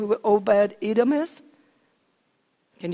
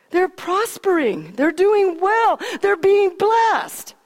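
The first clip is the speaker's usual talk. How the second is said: building excitement; 8 semitones higher, slower than usual. Acted. Preacher telling the congregation how Obed Edom's family is blessed, building excitedly. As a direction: build it up, climbing to a peak.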